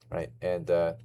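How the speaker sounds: normal talking voice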